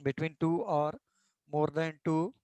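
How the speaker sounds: slow, even dictation pace for notes. Acted. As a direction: spell it out slowly so students can write. Teacher dictating a definition slowly. (धीमी गति से लिखवाना)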